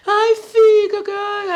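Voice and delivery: high, slow mock-tearful pleading; imitating her crying. Boss begging the maid to stay, mock crying. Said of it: "A mulher chorando".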